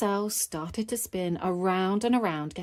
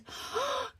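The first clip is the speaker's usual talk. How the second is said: high gasp of delight; sharp delighted intake of breath; excited gasp; 6 dB quieter than usual. Acted. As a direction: gasp in wonder like a thrilled child. Peppa spots the carousel.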